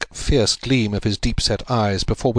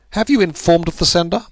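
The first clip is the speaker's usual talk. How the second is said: higher, brisk question; Holmes, probing; 9 semitones higher, 5 dB louder than usual. A narrator's reading. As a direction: check a detail quickly. Narrator voicing Holmes.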